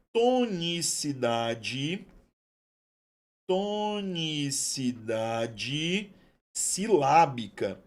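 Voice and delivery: very slow, syllables stretched out; drawn-out dictation. Shown as a demonstration. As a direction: dictate it slowly, holding each syllable. Spelling out term while writing.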